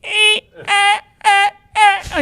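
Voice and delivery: put-on very high old man's stammering eh; grandpa stammer. Grandpa stammering to a restaurant on the phone. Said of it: "in a grandpa voice".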